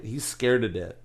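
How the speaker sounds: ordinary delivery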